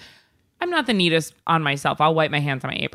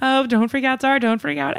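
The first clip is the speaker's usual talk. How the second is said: 1 mock soothing; 7 semitones higher than usual. Mock-calming Zara.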